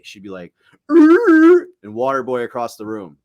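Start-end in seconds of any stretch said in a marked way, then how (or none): 0.9-1.7 high, loud imitated dog groan; dog groan imitation. Imitating the scared dog's groan, high and loud.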